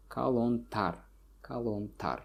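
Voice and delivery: kalontar stressed on tar; kalontar, final syllable stressed; stress on suffix. Shown as a demonstration. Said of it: "the stress falls on the suffix here".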